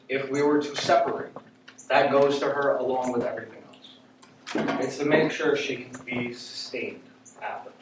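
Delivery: usual speaking voice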